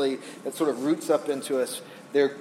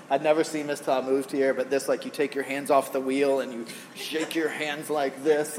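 normal talking voice

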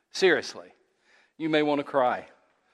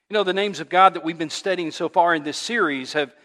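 normal talking voice

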